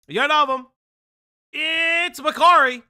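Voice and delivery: slow, loud, stretched hype intro; guest announcement. Acted.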